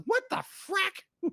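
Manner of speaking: high, slow, drawn-out disbelief; stunned disbelief. Retold or imitated. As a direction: act baffled by the ending. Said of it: "you're like".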